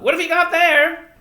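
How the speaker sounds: loud, high-pitched question across the street; woman hollering from a shop front; calling out. A narrator's reading. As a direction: call it out brightly, high and loud. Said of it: "called a woman from the front".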